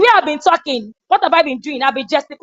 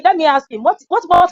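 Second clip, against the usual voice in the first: usual speaking voice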